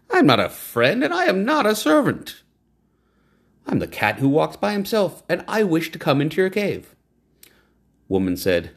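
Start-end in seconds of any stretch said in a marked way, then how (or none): 0.1-7.0 the cat. A narrator's reading. Cat voiced in story.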